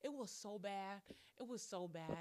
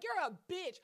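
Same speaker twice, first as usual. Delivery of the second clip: high snapped-back insult; mock insult; 9 semitones higher, 4 dB louder, faster than usual. Firing back at the cousin, high mock insult. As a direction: throw it right back at her. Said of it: "And I'm like".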